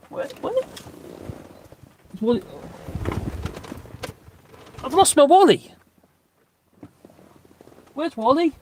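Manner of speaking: high sing-song call; high calling to the dog. Real, not acted. Owner calling to dog about the lost Wally toy, high sing-song. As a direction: coax the dog playfully.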